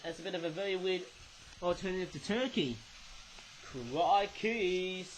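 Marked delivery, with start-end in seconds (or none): none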